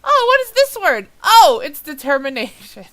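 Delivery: high, loud exaggerated "oh"; mock realization. Reader mocking their own confusion over an abbreviation, mock realization. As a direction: act out a loud, dawning discovery. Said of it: "so I'm just going".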